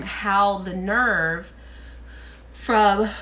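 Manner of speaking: talking through a yawn; words stretched by a real yawn. Real, not acted. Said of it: "I'm yawning".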